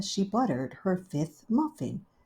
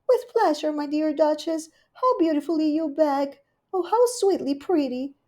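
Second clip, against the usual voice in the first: Rivy's voice; 9 semitones higher than usual. Acted. Rivy welcoming Duchess, high gushing hostess. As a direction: greet her sweetly and grandly.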